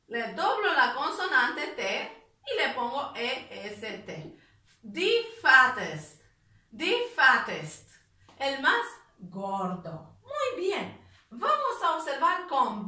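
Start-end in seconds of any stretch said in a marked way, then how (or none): 4.8-7.9 "the fattest" said slowly and louder, every sound clear; slow model pronunciation. Shown as a demonstration. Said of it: "model pronunciation slowed". ("the fattest" lento y claro, como modelo)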